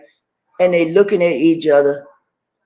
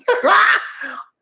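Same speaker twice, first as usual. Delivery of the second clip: short, high laughing shriek; high mock shriek. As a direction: burst out in a high cackling yelp. Mock outburst before chaos breaks loose.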